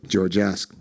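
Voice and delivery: plain reading voice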